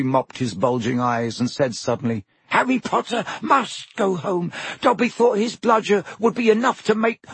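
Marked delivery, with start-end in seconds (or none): 2.5-7.2 high, eager elf squeak; house elf voice. Acted. Elf answering Harry, high squeaky voice. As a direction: pitch it up like a nervous house elf. Dobby voice.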